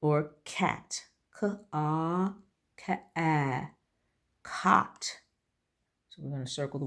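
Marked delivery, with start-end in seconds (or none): 1.4-5.2 "K-A, ka-a" stretched, then "cot"; sounding out. Shown as a demonstration. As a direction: sound it out slowly, letter by letter. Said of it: "Cot, which is some".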